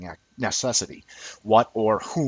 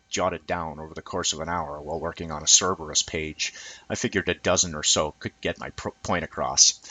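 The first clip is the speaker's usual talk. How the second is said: normal talking voice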